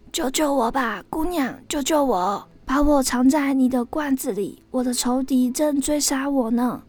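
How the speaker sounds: high, urgent plea for help; pleading snake. A narrator's reading. Hunted snake begging the girl to hide it, pleading. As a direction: beg to be hidden from a pursuer.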